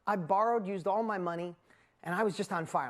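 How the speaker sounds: usual speaking voice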